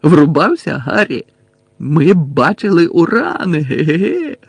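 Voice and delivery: drawn-out silly boast breaking into giggles; giggly, dazed Ron. A narrator's reading. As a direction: act addled, end on a goofy titter. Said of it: "знову кволо гигикнув Рон".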